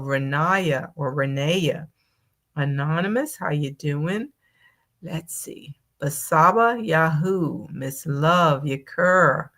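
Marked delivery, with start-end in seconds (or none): 5.0-5.8 breathy whisper to herself; a private thinking-aloud whisper; murmured under the breath; whispered aside. Real, not acted. Muttering to self while reading names.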